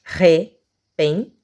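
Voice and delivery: "Repente" in three beats, re-pen-te; syllable by syllable. Shown as a demonstration. Teacher showing three syllables, "Repente" broken apart. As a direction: pause between syllables of "Repente". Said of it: "três sílabas".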